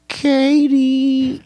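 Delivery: put-on high, squeaky baby-talk name; cute baby voice. Shown as a demonstration. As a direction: coo the name like a toy bear. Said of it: "in a cuter baby voice".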